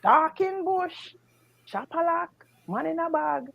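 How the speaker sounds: high, slow imitated chant; mock broken-English cry with pauses between phrases; Jamaican Chinese man. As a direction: call each phrase separately, high and drawn out. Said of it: "So him keep saying".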